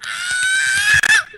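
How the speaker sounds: very high, loud cartoon scream; shrill scream. Snorkel screaming while charging up, very high. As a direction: shriek in panic. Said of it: "Snorkel was like".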